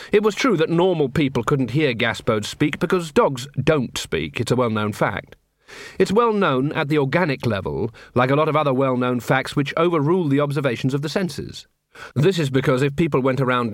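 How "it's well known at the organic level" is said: ordinary delivery, nothing marked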